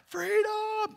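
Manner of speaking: high, drawn-out rallying cry; mock battle cry. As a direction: cry it out like a charging warrior. Mock battle cry after film scene.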